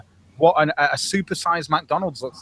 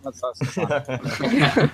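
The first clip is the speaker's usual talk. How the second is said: laughing through it; much slower than usual. Real, not acted. Laughing at own joke.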